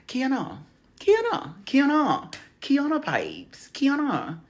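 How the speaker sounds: high-pitched, playful Australian accent on Kiana. Shown as a demonstration. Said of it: "I have to say it in my Australian accent".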